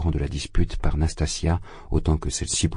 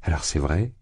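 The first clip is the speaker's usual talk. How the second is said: ordinary delivery, nothing marked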